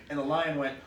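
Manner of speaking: ordinary delivery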